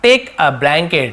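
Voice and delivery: each word stretched; very slow model phrase. Shown as a demonstration. Teacher slowly modelling a phrase for students. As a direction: say it slowly for beginners.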